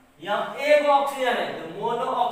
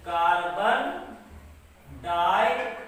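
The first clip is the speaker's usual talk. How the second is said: lower, word by word with pauses; dictation; 6 semitones lower than usual. Shown as a demonstration. Teacher dictating carbon disulfide for students to write, measured dictation.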